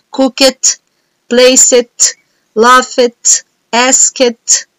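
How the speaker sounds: Cook it, place it, laugh it, ask it, each ending in a crisp T sound. Shown as a demonstration. Teacher to class, demoing the T sound of ed endings. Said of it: "todos terminam numa pronúncia de T".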